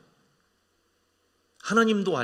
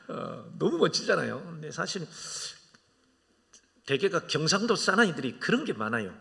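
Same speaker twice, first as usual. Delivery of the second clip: ordinary delivery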